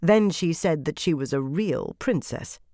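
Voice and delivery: ordinary delivery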